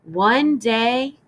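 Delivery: ordinary delivery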